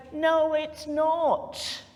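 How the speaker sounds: high, slow, emphatic no; firm rebuttal. Shown as a demonstration. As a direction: shut the excuse down flatly. Telling someone off for victim-blaming.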